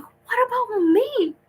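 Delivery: very high, slow whine of being left out; high drawn-out wounded whine; whiny mock plea. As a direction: squeak it out like a forgotten kid. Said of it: "and I'm like".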